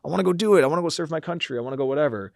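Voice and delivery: a hammed-up movie soldier eager to fight; eager movie hero. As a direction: play the keen recruit itching for war, pitch jumping up. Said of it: "you look at a character in a movie".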